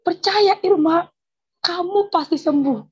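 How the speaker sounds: convincing assurance. Retold or imitated. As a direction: imitate the neighbour assuring her firmly. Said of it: "dia meyakini saya". (menirukan orang meyakinkan dengan lantang)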